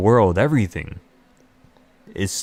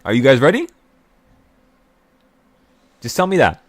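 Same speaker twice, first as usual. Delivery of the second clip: higher, louder hype call; excited call; 4 semitones higher, 5 dB louder, faster than usual. Real, not acted. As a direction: pump up the crowd. Rallying the audience.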